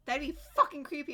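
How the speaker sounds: loud spooked outburst. Real, not acted. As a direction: blurt it out creeped out. Spooked by the dark scene.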